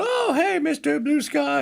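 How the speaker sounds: high, squeaky little tree creature, mock cheerful; Baby Groot. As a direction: imitate a tiny happy creature greeting its song. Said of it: "he's just going".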